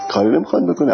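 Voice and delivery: ordinary talk, nothing marked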